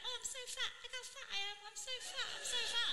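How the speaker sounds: high, whiny self-pity; whiny insecure girl. Retold or imitated. Insecure girl voiced in joke.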